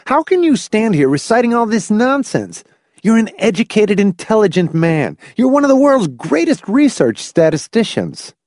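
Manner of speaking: high-pitched, incredulous angry tirade; heated outburst; roaring. A narrator's reading. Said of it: "Hamilton roared at the bewildered old man".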